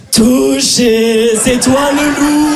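drawn-out playground tag call; slow, sing-song taunt rising high; playground child. Acted. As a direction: play a husky kid calling out in a game. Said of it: "des enfants comme moi qui se courent après".

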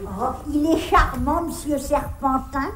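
low, soft approval; fond remark. Acted. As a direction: settle into a contented fond comment. Fond remark after shouting his name.

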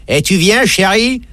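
a hammed-up hooker calling out; mock streetwalker call. Dédé mimics a streetwalker calling to a client, high and teasing. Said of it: "qui dit".

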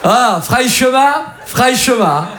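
slow drawn-out Marseille catcall; Marseille flirt. Acted. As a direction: stretch it out like a street flirt. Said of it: "une fille qui se fait draguer à Marseille". (drague marseillaise lente et appuyée)

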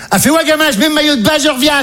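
high-pitched mother voice; excited mother. Retold or imitated. Mother tells son she's putting on her swimsuit, excited high voice. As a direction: pipe up high like an eager mum.

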